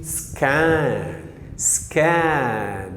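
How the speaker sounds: "سكان" very slow, S then hard K and stretched vowel. Shown as a demonstration. Teacher modelling C after S as K, slow and stretched. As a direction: draw out "سكان" as s-kaan. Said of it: "تنطق ك".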